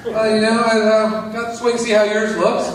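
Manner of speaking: lightly voiced skeptical neighbor; doubtful neighbor. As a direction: hedge with a chuckle.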